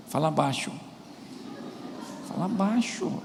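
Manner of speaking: soft, restrained voice telling her to keep it down; acting out a husband's quiet shushing; hushed husband. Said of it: "Aí seu marido fala pra senhora assim".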